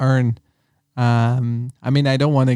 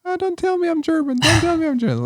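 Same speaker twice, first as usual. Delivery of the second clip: very high-pitched mock protest; squeaky put-on girlfriend voice; imitating girlfriend's protest; faster than usual. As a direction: mimic your girlfriend objecting, way up high. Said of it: "She's like".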